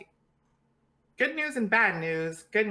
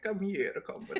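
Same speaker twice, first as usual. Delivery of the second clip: put-on Russian accent; 5 dB quieter than usual. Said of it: "speaking in a Russian accent".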